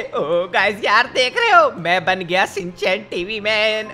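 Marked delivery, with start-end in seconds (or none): 1.1-1.7 loud, much higher show-off; excited boast. Acted. Newly upgraded hero showing off to viewers, loud excitement. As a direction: crow about the new power.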